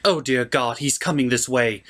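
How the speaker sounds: ordinary talk, nothing marked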